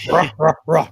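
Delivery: loud hammed-up macho grunt; Tim Allen grunt. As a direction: grunt like a revved power tool. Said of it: "Tim Allen style".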